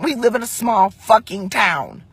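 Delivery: usual speaking voice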